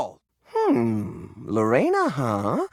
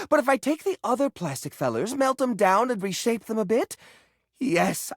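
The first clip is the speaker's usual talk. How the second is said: ordinary delivery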